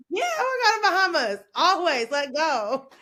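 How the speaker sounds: high, loud, rushed whoop of yes; excited agreement. Retold or imitated. Traveler saying yes to lover's Bahamas idea, excited. Acting out delighted reply about trip.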